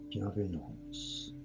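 usual speaking voice

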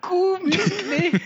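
high, very slow, laughing through the words; nearly crying with laughter. Real, not acted. Rereading the absurd title, crying with laughter. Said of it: "c'est génial".

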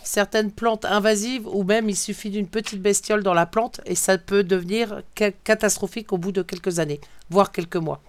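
ordinary talk, nothing marked

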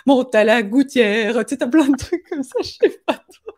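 voix moqueuse plus aiguë, un peu plus forte; mental qui se moque. Retold or imitated. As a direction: imiter le mental qui la nargue. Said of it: "t'as le mental qui se fout de toi". (le mental qui se moque d'elle, voix railleuse aiguë)

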